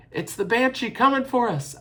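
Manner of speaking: high, loud, terrified scream. A narrator's reading. Young sister wakes and screams about the Banshee. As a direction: shriek in fear, waking up. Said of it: "Eilish screamed".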